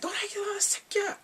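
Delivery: very high squealed curse; mock cussing. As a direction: swear in a shrill imitated outburst. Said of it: "she's cussing".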